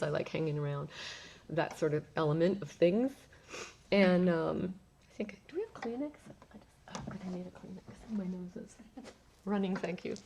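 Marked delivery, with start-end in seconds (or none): 5.1-10.1 murmured aside, barely voiced in places; quiet aside. Real, not acted. Speaker turns aside for a tissue, quiet murmur. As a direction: drop the voice and ask for a tissue.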